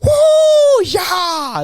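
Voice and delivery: mock a rowdy fan yelling at a match; hammed-up drunk-fan whoops; obnoxious cheering. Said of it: "he's like".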